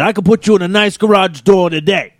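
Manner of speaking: hammed-up street hustler pitch; mock fast-talking door peddler; pushy salesman. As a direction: hustle a passer-by into buying. Plays pushy garage door salesman.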